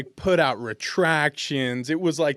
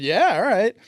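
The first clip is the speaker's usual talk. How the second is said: eager agreement; 8 semitones higher, 8 dB louder than usual. Retold or imitated. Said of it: "So I said".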